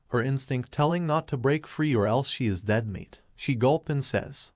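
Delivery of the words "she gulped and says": plain reading voice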